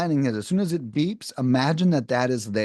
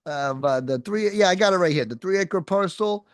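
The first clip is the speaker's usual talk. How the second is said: higher, halting, finding it mid-sentence; shuffling through papers; 6 semitones higher than usual. Shown as a demonstration. Sales rep pretending to look through papers while calling a seller. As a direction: sound like you're hunting through your notes. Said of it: "a bunch of papers nearby".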